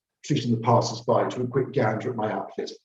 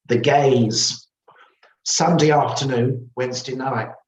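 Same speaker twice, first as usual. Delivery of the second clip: lightly voiced old woman, higher and louder; insistent shop owner; 5 semitones higher, 5 dB louder than usual. A narrator's reading. Shop owner repeating her gossip to the narrator, insistent. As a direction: spell it out emphatically.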